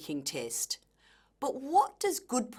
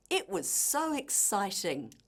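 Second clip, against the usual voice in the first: excitement apparent in the voice, lively rise and fall; excited. Shown as a demonstration. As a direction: let the thrill show, swooping down at the end. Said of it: "make this apparent in your voice".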